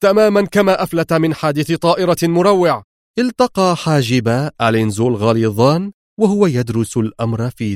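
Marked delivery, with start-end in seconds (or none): 0.0-2.8 higher-pitched, emphatic sternness; insistent, strict reply; stern retort. A narrator's reading. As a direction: answer back hard and certain. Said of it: "أجابه رودريغز في صرامة".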